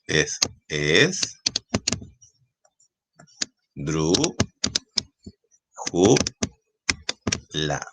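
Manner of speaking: "es, dru, ju, la" with long pauses between syllables. Shown as a demonstration. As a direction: sound out each syllable on its own. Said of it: "la sílaba tónica".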